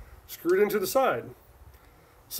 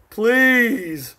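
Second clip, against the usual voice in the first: a louder, scornful "Please."; scornful "Please." said louder and higher; scoffing; 5 semitones higher, 7 dB louder than usual. Real, not acted. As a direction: dismiss it outright with "Please.". Dismissing a rival marker.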